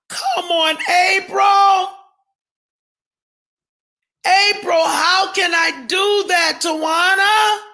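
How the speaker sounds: exasperated shout. Real, not acted. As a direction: yell out the frustration at being accused. Defending herself against accusations.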